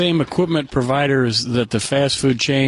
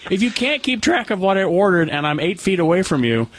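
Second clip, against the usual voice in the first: ordinary talk, nothing marked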